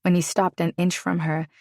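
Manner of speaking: ordinary delivery, nothing marked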